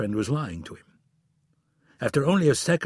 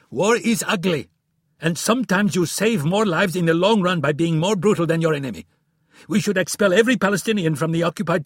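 ranting; 8 semitones higher, 6 dB louder than usual. A narrator's reading. Friedman ranting in quarrel.

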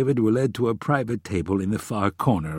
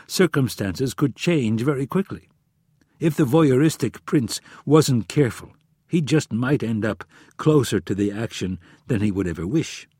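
plain reading voice

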